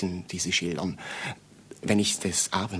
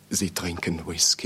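whispered; 4 semitones lower than usual. Acted. Detective says it softly, unvoiced.

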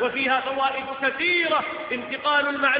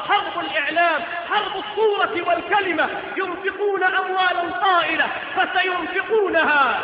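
impassioned, louder sermon cries; preaching at full force; 5 semitones higher, 4 dB louder than usual. Acted. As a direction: build each clause to a fiery peak. Sermon climax, repeated rising cries. (خطبة حماسية بصوت جهوري)

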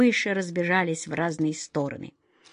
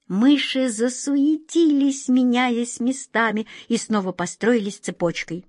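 ordinary delivery

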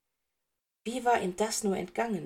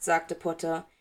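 usual speaking voice

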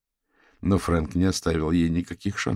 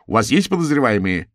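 heckler calling out, higher and louder; raised-voice challenge from the crowd; shouted question; 4 semitones higher, 6 dB louder than usual. A narrator's reading. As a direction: call it out from the audience. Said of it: "выкрикнул кто-то".

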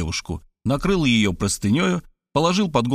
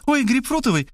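"Ой" jumps much higher in delight; joyful squeal; 12 semitones higher than usual. A narrator's reading. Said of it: "радостно взвизгнула Варвара".

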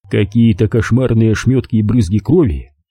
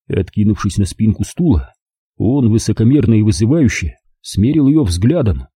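ordinary delivery, nothing marked

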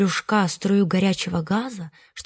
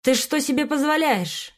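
higher, sharper outraged teacher; indignant scolding; 6 semitones higher than usual. A narrator's reading. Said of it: "возмутилась Павлиноглазка".

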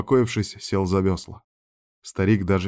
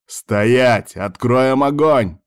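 drawn-out, loud military threat; soldier's loud shout; 4 semitones higher, 6 dB louder, much slower than usual. A narrator's reading. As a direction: bellow a halt order at fugitives. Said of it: "как можно громче закричал военный".

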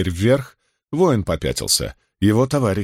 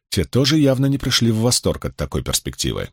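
usual speaking voice

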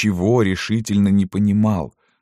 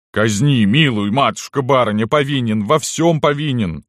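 raised-voice confession of a cowed servant; abject pleading; 6 semitones higher, 4 dB louder than usual. A narrator's reading. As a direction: grovel before the mistress. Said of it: "закланялся Никанор". (покаянно, умоляюще)

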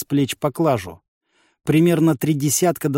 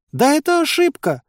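very high, exasperated cry; heated outburst; 13 semitones higher, 4 dB louder than usual. A narrator's reading. Village head protests to the system, heated outburst. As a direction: blurt it out in frustration. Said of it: "в сердцах выкрикнула Ариша".

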